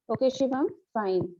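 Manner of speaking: usual speaking voice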